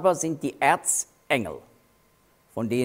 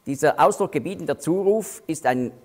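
ordinary delivery

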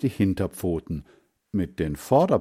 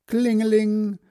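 high, sing-out bell jingle; imitated bell ringing; 13 semitones higher, 4 dB louder than usual. A narrator's reading. Ice-cream cart suddenly announces itself, imitated bell. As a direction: ring it out on one high held pitch. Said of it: "machte es auf einmal".

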